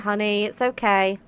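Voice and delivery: ordinary talk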